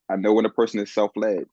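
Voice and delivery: normal talking voice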